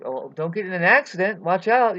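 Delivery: high, loud mock warning; shouted warning. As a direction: yell it out as an exaggerated alarm.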